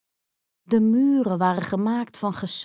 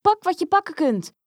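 much higher, louder urgent shout; urgent cry; 9 semitones higher, 4 dB louder than usual. Acted. Grietje shouts at Hans as the house collapses, high and urgent. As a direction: hurry him now. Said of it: "riep Grietje".

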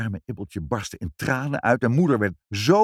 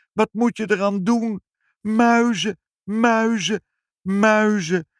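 very high, loud repeated cries; alarmed outcry; 6 dB louder, slower than usual. A narrator's reading. Mother relays the cats' mouse news, high alarmed cries. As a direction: build to a despairing climax.

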